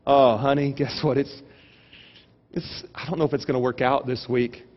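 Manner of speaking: low, hesitant, softly worried; mock discouraged. Husband to wife over the budget, mock discouraged. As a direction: pretend to break bad news gently.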